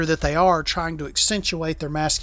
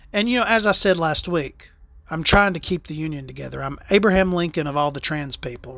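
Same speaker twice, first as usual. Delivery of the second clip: ordinary talk, nothing marked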